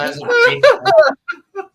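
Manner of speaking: loud, lofty, drawn out; mock grand announcement. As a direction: hail him like a herald.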